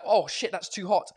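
normal talking voice

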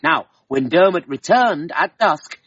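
usual speaking voice

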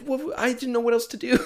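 squeaky high, panicked mock voice; a high, flustered mock whine; clueless squeak. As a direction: play him as a clueless, panicky kid squeaking. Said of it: "He's like".

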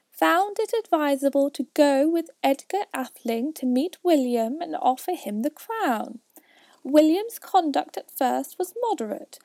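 high, loud lecturing mouse; pompous mouse. Acted. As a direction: recite history like a stuffy mouse.

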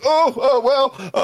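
high, flustered fumbling when called out; flustered stammer. Retold or imitated. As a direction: play the shy guy caught off guard, pitch shooting up. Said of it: "I'm like".

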